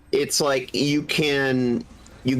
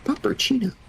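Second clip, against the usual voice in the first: high excited squeal; squealed with delight; 12 semitones higher than usual. Real, not acted. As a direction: squeak the word out in glee. Gleeful echo of cute word.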